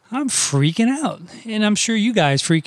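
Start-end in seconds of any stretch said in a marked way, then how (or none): 0.1-1.2 freaking out. Retold or imitated. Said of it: "I was thinking".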